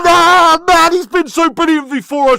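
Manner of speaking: high, very loud wail, gradually easing; anguished shouting. Real, not acted. Fan laments Mazraoui's clumsy tackle, anguished shouting. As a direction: howl in despair at your own player.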